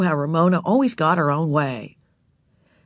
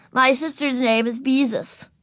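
generous; 7 semitones higher than usual. A narrator's reading. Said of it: "and then added generously".

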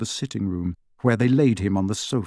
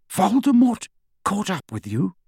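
sharp, higher-pitched questioning; Lupin, sharp; 8 semitones higher than usual. Acted. Lupin questioning Harry about Voldemort, sharply. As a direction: question it sharply in alarmed disbelief. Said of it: "said Lupin sharply".

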